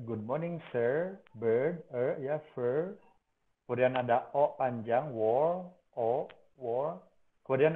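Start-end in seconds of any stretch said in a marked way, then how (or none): 0.1-2.4 "sir" and "bird" with a long schwa; slow example words. Shown as a demonstration. Teacher models the long schwa in "sir" and "bird". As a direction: draw out the vowel in "bird". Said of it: "Kemudian ada E".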